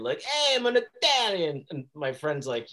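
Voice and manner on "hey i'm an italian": loud, high mock Italian; hammed-up Italian accent, called out. Showing friends his Italian character, in a loud Italian accent. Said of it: "started talking, like, in Italian".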